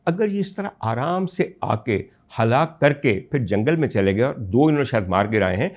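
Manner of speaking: usual speaking voice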